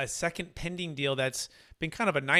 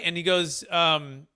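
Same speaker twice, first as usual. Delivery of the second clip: ordinary talk, nothing marked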